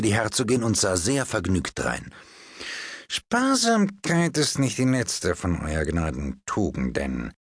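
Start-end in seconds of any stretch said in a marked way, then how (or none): 3.1-7.3 slow, smooth fortune-teller reading a palm; a palmist humouring a duchess; fawning palmist. Acted. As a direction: soothe and flatter as you read her hand. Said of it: "fuhr Herr Podgers fort".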